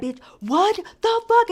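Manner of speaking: high, loud, stretched-out disbelief; outraged outburst. Retold or imitated. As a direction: imitate a shocked, furious reaction. Retold outburst of splashed girl.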